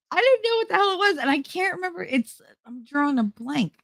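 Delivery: very high-pitched, quick protest; exasperated. Real, not acted. Storyteller admits forgetting the mystery word, high-pitched exasperation.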